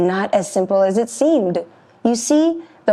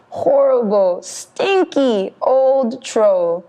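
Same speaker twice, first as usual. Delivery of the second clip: plain reading voice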